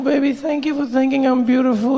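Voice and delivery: put-on gushing thanks to a lover; high voice of a flattered woman; woman replying in bed. Woman replying to amorous partner.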